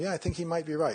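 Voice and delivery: second inner voice. Acted. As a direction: chime in as another voice in the head. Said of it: "some other voice starts going".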